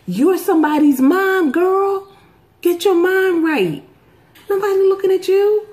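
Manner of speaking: high, teasing self-scold; telling herself to get her mind right. Retold or imitated. Mom laughing at her own vanity, teasing high voice. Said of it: "So I was like".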